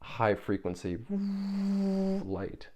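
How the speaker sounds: words sung out high and slow; a high, slow, drawn-out voice for the light. Shown as a demonstration. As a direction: lift into a high thin tone.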